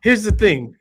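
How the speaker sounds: "Here's the thing." punched like a mock announcement, dropping at the end; quoting Lee. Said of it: "it literally says".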